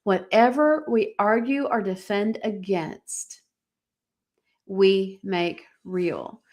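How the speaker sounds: slow, spaced-out emphasis, pausing before "we make real"; deliberate repeat. Shown as a demonstration. Host repeats a key line for her warriors, slow and deliberate. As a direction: draw out "we make real" word by word. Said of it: "That's worth repeating".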